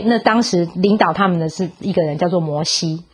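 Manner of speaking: normal talking voice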